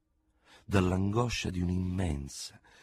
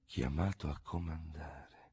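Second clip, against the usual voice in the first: slow, much softer, stunned repetition; crushed; 9 dB quieter, slower than usual. A narrator's reading. General repeating the newspaper, crushed and hushed. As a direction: let the words die in his mouth. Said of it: "lasciò cadere il giornale affranto".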